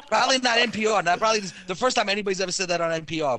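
amused, laugh-filled delivery. Real, not acted. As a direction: still cracking up while speaking. Cracking up over the risqué joke.

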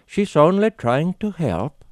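a hint of Scandinavian lilt; Scandinavian accent. A narrator's reading. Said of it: "she said in a Scandinavian accent".

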